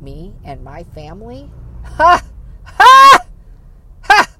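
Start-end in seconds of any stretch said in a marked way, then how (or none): 1.8-4.3 high, loud fake cackle; mock laugh. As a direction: cackle in scornful mockery. Mocking laugh at gossips.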